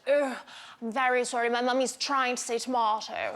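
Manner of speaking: put-on posh English accent, tomato said "to-MAH-to"; embarrassed English daughter. Daughter correcting Mummy's order, posh English accent, "to-MAH-to". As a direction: imitate an apologetic English child, tomato with a long "ah". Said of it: "she gets so embarrassed. She'll say".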